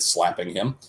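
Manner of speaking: normal talking voice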